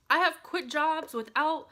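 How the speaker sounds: ordinary talk, nothing marked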